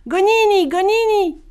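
very high, long-carrying call. A narrator's reading. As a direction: call the name up to the sky. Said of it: "Boon Boy l'appela". (appel très aigu, lancé au loin)

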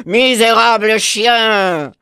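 slow, loud furious cry; a big cat's angry roar of an insult; furious panther. Acted. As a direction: spit the insult out in fury. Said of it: "s'écria la panthère furieuse".

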